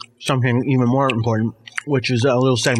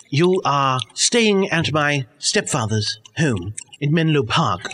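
ordinary talk

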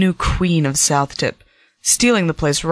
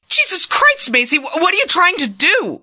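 sharp, much higher angry cry; angry outburst; 13 semitones higher than usual. Acted. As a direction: lash out in shocked anger. Stella shocked, angry at Macy.

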